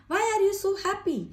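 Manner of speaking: slow, high asking tone; questioning tone. Shown as a demonstration. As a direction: ask it like a real question. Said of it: "हमारे face पर जो है expression होना चाहिए". (धीमा, ऊँचा सवाल वाला लहजा)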